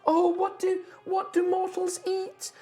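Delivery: high, flustered and unsure; a high voice fumbling for what mortals eat; wood spirit. Acted. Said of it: "is like".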